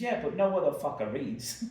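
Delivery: loud, high mock Boston barmaid retort; horrible Bostonian accent. Barmaid answering him about the bar, brash Boston accent. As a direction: fire back in a bad Boston accent. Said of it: "my horrible Bostonian accent".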